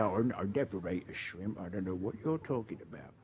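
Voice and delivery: hushed mock-innocent denial. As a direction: play someone primly denying everything, softly. Mimicking holier-than-thou listeners denying it.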